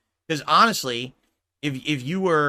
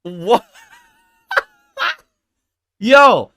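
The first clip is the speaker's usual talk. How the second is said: laughing disbelief; 10 semitones higher, 12 dB louder than usual. Real, not acted. Laughing after shock.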